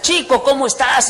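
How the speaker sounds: loud, high-pitched hearty greeting; boisterous Venezuelan. Retold or imitated. Venezuelan priest bursts in to visit his friend, boisterous imitation. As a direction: greet him noisily, like a rowdy Venezuelan friend. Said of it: "Ya ven que son medios escandalosos".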